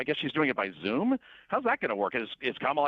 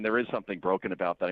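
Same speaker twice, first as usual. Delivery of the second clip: normal talking voice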